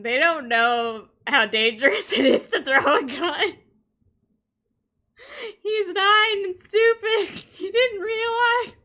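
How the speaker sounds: high, loud, helpless laughing protest; losing it laughing while explaining; cracking up. Real, not acted. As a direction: squeal it through laughter. Cracking up defending absurd story.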